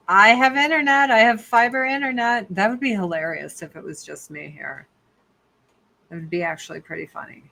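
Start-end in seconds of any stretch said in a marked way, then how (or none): 0.1-2.5 louder, higher, insisting on internet; insistent. Real, not acted. Telling the host she has fiber, insistent and loud. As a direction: prove it, loud and sure.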